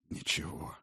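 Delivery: whispered with a strained smile; forced smile. Acted. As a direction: whisper it through effort. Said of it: "через силу улыбнулся дракон".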